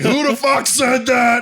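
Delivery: high, drawn-out outraged shout; mock angry yell. Somebody comes across the net at the lost boots' heckler, outraged shout. Retold angry radio call, raised voice.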